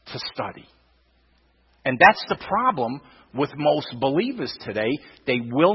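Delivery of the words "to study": hushed. Real, not acted. Preacher stressing study to the congregation, hushed and low. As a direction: drop to a low, soft "to study".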